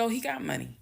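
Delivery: half-strength retold yelling; mock yell. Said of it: "I'm yelling".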